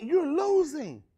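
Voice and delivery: normal talking voice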